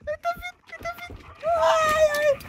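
very high frightened squeal; panicked shriek. Real, not acted. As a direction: squeal in terror. Monster approaching.

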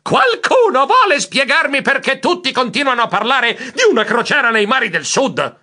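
high squeal, then exasperated demand; shriek, then demand an explanation at high pitch; shouting. A narrator's reading. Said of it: "Io gridai". (grido acuto ed esasperato)

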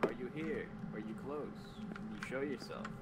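hushed questions to the dark; talking to a ghost. Acted. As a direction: softly summon the spirit. Calling to the ghost.

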